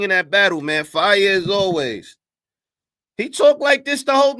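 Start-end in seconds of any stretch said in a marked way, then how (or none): 3.2-4.4 rising, louder, incredulous; can't believe what he's hearing; loud disbelief. Real, not acted. Streamer reacting to the interview, loud disbelief. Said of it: "No way he talked like this".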